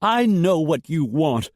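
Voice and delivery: high, loud, ragged outburst; ragged cry. A narrator's reading. Jessica crying out at Dietrich, ragged. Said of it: "Jessica cried raggedly".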